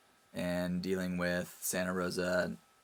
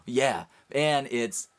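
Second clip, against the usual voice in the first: excited confirmation; 5 semitones higher, 8 dB louder, much slower than usual. Retold or imitated. Retells his triumphant reveal.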